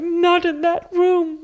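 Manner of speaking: very high, stretched-out squeal; retold refusal. Student refusing the teacher, high drawn-out mock refusal. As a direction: squeal the refusal slowly, hammed up.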